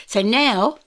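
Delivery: ordinary talk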